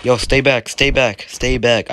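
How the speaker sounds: ordinary talk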